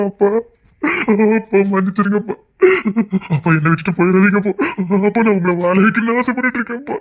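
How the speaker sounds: sobbing plea between gasps; tearful, remorseful lament; weeping apology. Acted. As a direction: beg your father through tears. Weeping, begging father's forgiveness.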